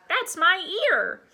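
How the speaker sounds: high, loud, slow complaint; pained high-pitched objection; shrieked protest. A narrator's reading. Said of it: "Chipper shrieked".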